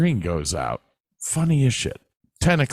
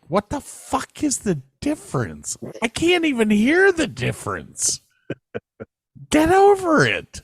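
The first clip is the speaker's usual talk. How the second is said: exasperated retort. Retold or imitated. Retelling his retort to a girlfriend, mock exasperated. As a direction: scoff at how petty the correction is. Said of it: "And I'm like".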